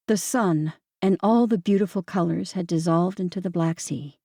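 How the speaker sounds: ordinary delivery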